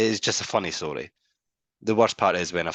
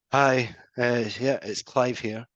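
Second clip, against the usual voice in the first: slow, flat-toned caller, a little higher; dull caller; 4 semitones higher, slower than usual. Retold or imitated. Salesman imitating a dull prospect named Clive, slow and lifeless. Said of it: "the guy that was".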